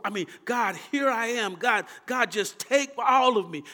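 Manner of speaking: normal talking voice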